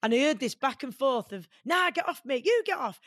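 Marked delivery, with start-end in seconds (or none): 1.7-3.0 mock Manc squabble. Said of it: "that Manc accent".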